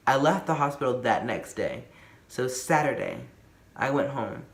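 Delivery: ordinary talk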